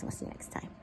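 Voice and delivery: soft whispered goodbye. Real, not acted. Signing off to viewers at the end of the vlog, whispered. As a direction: breathe the sign-off under your voice.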